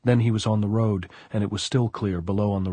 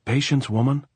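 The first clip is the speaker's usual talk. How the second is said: raised, higher voice calling upward; 5 semitones higher, 4 dB louder than usual. A narrator's reading. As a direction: call up to her over a distance. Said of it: "Anselmo called up".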